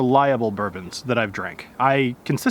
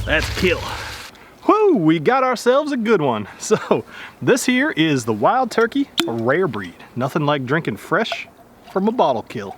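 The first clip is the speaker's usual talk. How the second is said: bad Southern accent. Host playing a Southern turkey hunter for the viewers, hammed-up Southern accent. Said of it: "my insultingly bad Southern accent".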